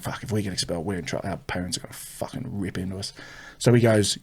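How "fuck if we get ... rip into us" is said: low, very soft retold fretting; quietly dreading it together; hushed worry. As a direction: drop to an anxious undertone. Cousins fearing expulsion after being caught.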